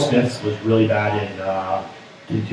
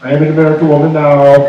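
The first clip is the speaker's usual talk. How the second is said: mock South African accent; 4 semitones higher, 6 dB louder than usual. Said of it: "The South African accent".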